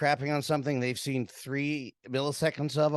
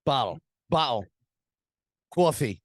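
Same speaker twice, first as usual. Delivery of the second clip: a Brooklyn "Bottle, bottle" with a stopped t, then "cawfee"; Brooklyn accent. Retold or imitated. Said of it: "Kelly's parents are both from Brooklyn".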